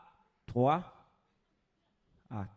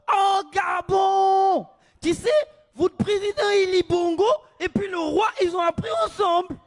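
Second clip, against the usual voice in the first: excited Moroccan local; 8 semitones higher, 9 dB louder than usual. Retold or imitated. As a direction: greet the stranger with big enthusiasm.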